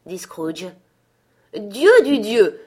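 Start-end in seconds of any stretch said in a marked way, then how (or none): 1.6-2.6 very loud, slow, high outcry; startled exclamation. A narrator's reading. Scrooge surprises his nephew's household, startled exclamation. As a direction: cry it out, drawn out and loud.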